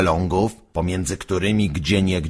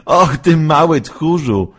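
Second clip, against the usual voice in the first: teasing laugh; 9 semitones higher, 7 dB louder than usual. Acted. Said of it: "zaśmiał się Waluś".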